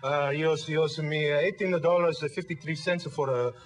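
put-on a lighter Italian dad accent, slow and careful; the dad repeating carefully, lightly accented. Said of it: "just repeat exactly what he said".

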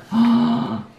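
high gasp of delight; sharp, high intake of breath; surprised gasp. Real, not acted. Excited surprise at photos.

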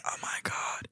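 hushed, whispered mock disbelief; retold starstruck gasp under the breath; awestruck whisper. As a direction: whisper it as if quietly freaking out. Retold starstruck reaction, freaking out.

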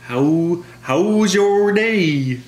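high, slow, overdone Minnesota "how's your day?"; a badly hammed-up Minnesota accent; failed Minnesota accent. Shown as a demonstration. Coworker mocks her Minnesota accent, high and slow. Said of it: "That's not how we sound it".

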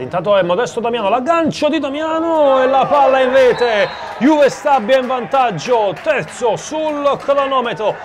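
breathless italian goal commentary. Real, not acted. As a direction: erupt as the ball hits the net. Goal scored, commentator erupts.

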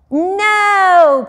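high, loud, drawn-out NO; adamant, acted refusal; insistent protest. A narrator's reading. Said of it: "Peter insisted".

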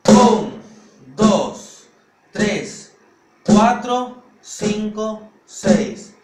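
high, spaced-out teaching count; slow loud count. Shown as a demonstration. Drum teacher introducing a six-note paradiddle, slow loud count. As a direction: call each number separately, loud and deliberate.

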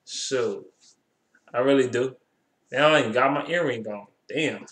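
ordinary talk

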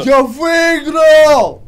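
drawn-out triumphant yell; slow victory shout. Real, not acted. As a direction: stretch out the win call. Shouting win.

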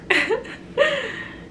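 high-pitched laugh. Real, not acted. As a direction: laugh at your own change of plan. Laughs at her changed plan.

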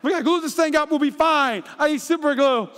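mock-panicked dad hunting for glue; frantic eighties kid. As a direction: act like super glue fixes everything. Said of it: "I'm an eighties kid".

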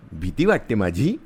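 ordinary delivery

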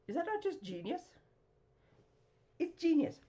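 delighted. Real, not acted. Praising the card.

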